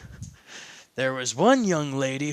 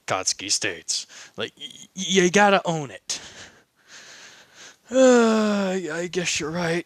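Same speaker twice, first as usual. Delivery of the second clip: usual speaking voice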